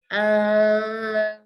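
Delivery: long, loud "Ah" buzzing in the nose; nasal ah. Shown as a demonstration. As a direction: hold "Ah", pushing it through the nose. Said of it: "feel a vibration almost from my nose".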